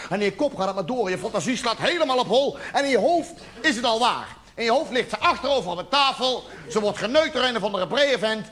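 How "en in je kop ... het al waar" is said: ordinary delivery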